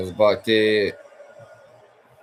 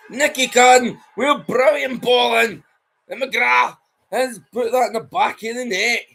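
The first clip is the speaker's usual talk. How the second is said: shouting, higher and louder, still buzzing; excited commentary; 8 dB louder, slower than usual. Acted. Commentator shouting praise for the cross and finish. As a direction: ride the goal excitement through the replay.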